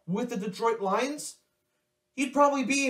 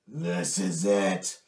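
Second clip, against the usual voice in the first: grim dread; 13 semitones lower, much slower than usual. Retold or imitated. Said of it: "I'm like".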